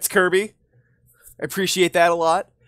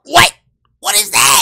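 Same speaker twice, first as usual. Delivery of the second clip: real high, loud burst of disbelief; shocked shout; 14 semitones higher, 10 dB louder than usual. Real, not acted. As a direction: yell it in startled confusion. Startled by the game's announcement.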